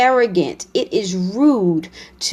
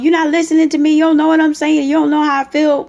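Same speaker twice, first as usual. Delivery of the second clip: raised, higher, louder mock argument yell; screaming and hollering; 5 semitones higher, 4 dB louder, faster than usual. Upset partner yelling mid-argument, hammed-up holler. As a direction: holler it like you've lost your temper in a fight. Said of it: "You're just screaming and hollering".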